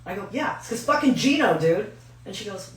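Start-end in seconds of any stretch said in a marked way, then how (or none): 0.3-1.9 loud, high-pitched retold griping; raised-voice comic grumble; exasperated reply. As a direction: blurt it out in mock exasperation.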